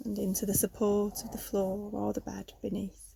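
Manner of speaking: calm yoga nidra guidance with long pauses; guided meditation. Real, not acted. Leads a yoga nidra relaxation.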